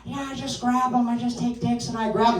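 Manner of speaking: high-pitched celebrity impression; Jennifer Coolidge. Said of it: "we have Jennifer Coolidge with controversial statements".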